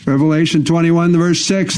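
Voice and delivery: ordinary talk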